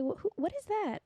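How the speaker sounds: startled people. Retold or imitated. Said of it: "People like".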